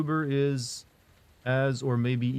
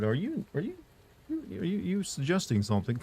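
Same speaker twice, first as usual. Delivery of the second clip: mock suspicious; 6 semitones higher, 4 dB quieter than usual. Mock suspicious reply to chat.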